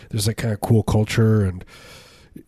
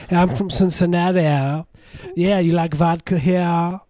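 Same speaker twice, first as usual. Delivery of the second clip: put-on much higher, weird made-up Cincinnati accent; mock Cincinnati accent; 8 semitones higher than usual. Host imitating a Cincinnati local offering vodka, weird high accent. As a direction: play a Cincinnati local with a silly high twang. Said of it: "They also have that weird accent there".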